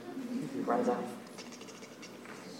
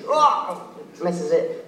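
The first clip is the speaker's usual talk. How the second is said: plain reading voice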